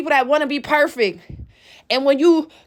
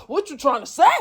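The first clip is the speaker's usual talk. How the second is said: lower, defensive mock rant of someone feeling attacked; mock defensive attack mode; 4 semitones lower than usual. Roommate snapping back at a reminder, mock defensive. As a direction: imitate a touchy roommate getting defensive. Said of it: "And here they go in attack mode".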